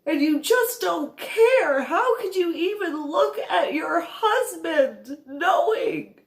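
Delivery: furious through tears, holding nothing back; crying rant. Retold or imitated. Tearful rant at mother.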